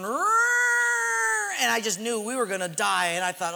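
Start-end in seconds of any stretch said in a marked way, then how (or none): 0.0-1.6 high mechanical whine of an engine; engine roar. Retold or imitated. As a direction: imitate the straining engine. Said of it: "the engine going".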